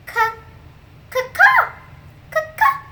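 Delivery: high squawking ca-ca; bird cawing. Acted. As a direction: caw it like a bird. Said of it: "We're not being birds".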